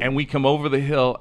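normal talking voice

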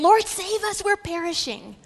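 panicked plea. Acted. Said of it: "They came and woke him".

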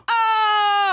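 high shocked "Oh!"; mock class gasp. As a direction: gasp like a whole class just heard it. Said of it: "they all go".

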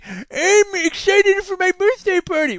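put-on slow high child voice; odd child voice. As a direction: squeak like a strange little boy. Child with old-man whimsy.

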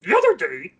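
high, loud, slowed puppet voice; Uncle Matt impression. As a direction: put on a Grover-like character voice. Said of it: "It's a little Grover as well".

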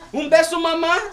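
put-on high little boy's voice, apologizing and asking for a kiss; sweet child. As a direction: coax the teacher like a sorry little boy.

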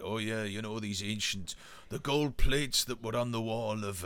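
low-key mock Welsh accent; a hint of a Welsh enthusiast; imitated Welsh accent. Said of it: "he does it in a Welsh accent".

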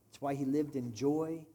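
usual speaking voice

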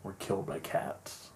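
hushed, breathy reveal; dramatic whisper. Pretend. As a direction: whisper it like a dark secret.

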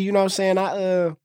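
normal talking voice

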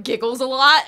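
usual speaking voice

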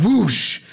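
loud, high swooping whoosh; dramatic whoosh. Acted. Game master sounds the camera's sudden pan out, dramatic whoosh. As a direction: let the whoosh burst out suddenly.

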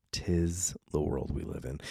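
low, soft aside; subdued repeat. Real, not acted. Repeats closing remark, subdued second time.

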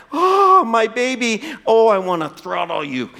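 high anguished cry of a frantic mother; hammed-up scared and mad mom; anxious angry mom. Mother finding her lost son, half frantic, half furious. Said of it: "that combination of anger, scared".

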